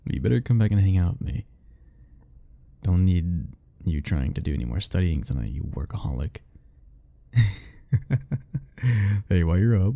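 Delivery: normal talking voice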